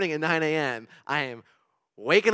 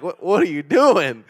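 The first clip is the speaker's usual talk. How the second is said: slow, loud, higher baffled voice of the friend; imitating a baffled friend, drawn out and loud; friend's voice; 4 semitones higher, 14 dB louder, slower than usual. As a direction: play the puzzled friend on the line. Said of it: "that's kinda how he talks".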